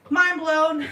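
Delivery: loud, high mind-blown exclamation; big amazed proclamation. Retold or imitated. Exclaims amazement at her own find.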